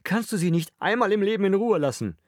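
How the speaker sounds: high-pitched, exasperated outburst; furious rebuke. A narrator's reading. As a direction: lash out in exasperation, voice way up. Angry rebuke of the father.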